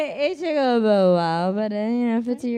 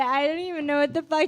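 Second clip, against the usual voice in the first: ordinary talk, nothing marked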